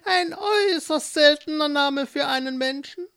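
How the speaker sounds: put-on high, sly old witch. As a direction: play the witch, curious and pleased. Witch voiced in story.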